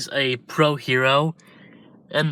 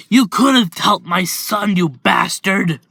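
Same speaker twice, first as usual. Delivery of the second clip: furious yell; 6 semitones higher, 5 dB louder than usual. Acted. Father pinning the hero to a wall, furious shout. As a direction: spit it at the man who let his son die.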